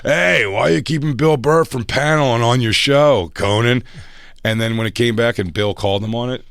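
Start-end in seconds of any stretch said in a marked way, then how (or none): 0.0-3.8 high, loud mock manager voice; hammed-up pushy manager; imitating manager. Imitating the manager pressing Conan, loud and high. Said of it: "He goes".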